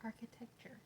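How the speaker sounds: numb mouth, whispered word; numb from the dentist. Real, not acted. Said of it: "Still can't work right".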